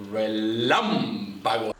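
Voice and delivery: "relámpago" said slowly, stress on "lám"; slow Spanish demo. Shown as a demonstration. Host teaching viewers the word of the week, slowly. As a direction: draw out "relámpago" syllable by syllable. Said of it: "The word".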